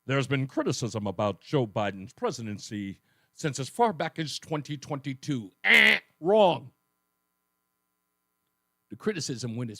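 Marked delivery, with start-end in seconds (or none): 0.0-5.5 lightly put-on newscaster, pitched lower; news media voice. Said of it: "the news media and they'll tell you". 5.6-6.7 loud buzzer noise, then the verdict; mock wrong-answer buzzer. Game-show buzzer rebuttal of quote.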